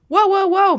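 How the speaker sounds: high, loud startled cry; startled whoa. Acted. Reader voicing a startled man, loud and high. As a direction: jump back in shock. Reading Jesus's startled reaction aloud.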